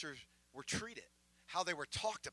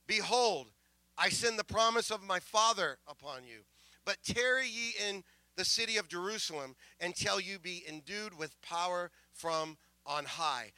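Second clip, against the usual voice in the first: loud, raised preaching delivery, slowing at the end; scripture proclamation; 5 dB louder than usual. Retold or imitated. Preacher declaring his text from Luke, ringing scripture proclamation. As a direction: proclaim the verse, stretching the last phrase.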